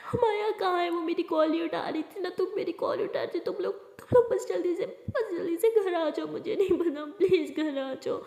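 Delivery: high, loud, broken sobbing speech; crying on the phone. Acted. Rimsha begs her friend on the phone to come home, sobbing. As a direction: stammer through tears, begging. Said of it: "Rimsha बहुत ज़्यादा रो रही है".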